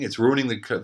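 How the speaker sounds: ordinary talk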